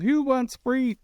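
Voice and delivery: high-pitched imitation of a crowd call; mock calling out. Co-host imitates the host offering giveaways to a crowd, calling out. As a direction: throw it out high, like hailing a crowd.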